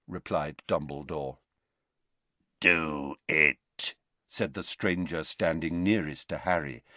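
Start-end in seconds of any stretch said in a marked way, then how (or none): 2.6-3.9 rasping Greyback. A narrator's reading.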